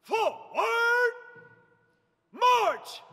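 high shouted forward, crisp march; drawn out parade commands. Shown as a demonstration. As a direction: command shouted across the field. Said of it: "emphasizing the ch in march".

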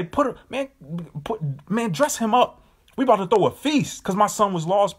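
high, excited father calling out; a father throwing a party for his lost son, high and excited; joyful father. Acted. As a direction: call for a feast like an overjoyed dad. Father voiced welcoming lost son.

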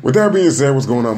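loud, high podcast greeting; loud hype show greeting; hype intro. Real, not acted. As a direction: burst into the show opener.

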